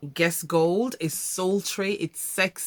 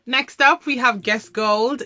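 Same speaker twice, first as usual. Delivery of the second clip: higher, louder hype for the next pick; excited intro; 5 semitones higher, 6 dB louder, slower than usual. Real, not acted. Vlogger introducing her favourite perfume to viewers, excited.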